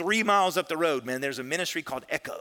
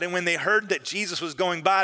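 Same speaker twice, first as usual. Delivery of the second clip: usual speaking voice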